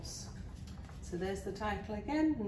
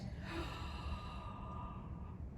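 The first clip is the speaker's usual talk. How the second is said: long, theatrical inhale; deep breath; 9 semitones higher, 10 dB quieter than usual. A narrator's reading. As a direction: draw in a huge breath before a howl. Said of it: "took a deep breath".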